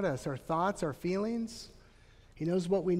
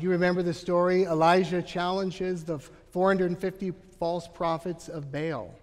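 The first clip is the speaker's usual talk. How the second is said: usual speaking voice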